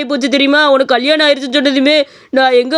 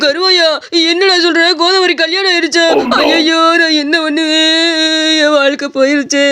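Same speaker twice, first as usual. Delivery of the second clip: mock despair; 2 semitones higher than usual. Jilted lover hears bad news.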